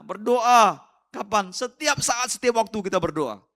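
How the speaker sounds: high, very loud preaching; forceful exhortation. Real, not acted. Preacher urging the congregation to pray at all times, loud and high.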